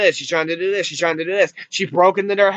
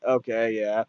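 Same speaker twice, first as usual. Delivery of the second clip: low, soft, unconvinced; unconvinced aside; 9 semitones lower, 8 dB quieter than usual. Retold or imitated. Viewer reacting to a padded plot, quietly unconvinced. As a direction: drop it quietly, going along reluctantly.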